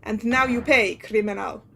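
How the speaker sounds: put-on hammy Russian sailor accent; bad Russian accent. Sailor questioning a stowaway, bad Russian accent. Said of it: "horrendous Russian accent I'm gonna be putting on".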